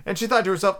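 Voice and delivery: ordinary delivery, nothing marked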